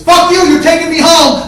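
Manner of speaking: high, full-volume yelling; angry screamed demand. Retold or imitated. Brother demanding a ride home, screaming in anger. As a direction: scream it as a furious order.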